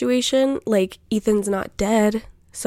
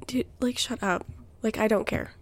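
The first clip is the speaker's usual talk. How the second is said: much softer, muttered inner thought; 7 dB quieter than usual. Retold or imitated. Her inner voice dismissing the brother's news, softly. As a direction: say it like thinking it to yourself. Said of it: "I would, in my head".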